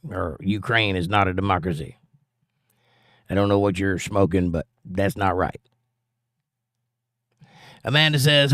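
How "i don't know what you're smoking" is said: usual speaking voice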